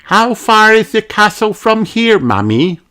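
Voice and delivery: high piglet voice; Peppa asking. A narrator's reading. Peppa asking Mummy how far, high piglet voice. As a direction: ask as the impatient little pig. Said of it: "asked Peppa".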